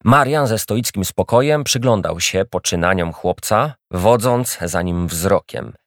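plain reading voice

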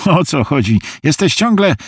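rising concern in a friend's voice; worried friend. Acted. Harvey senses trouble on the phone, worried.

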